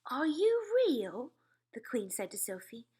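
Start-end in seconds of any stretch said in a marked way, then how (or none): 0.1-1.3 awed question. A narrator's reading. Queen wonders at Sophie.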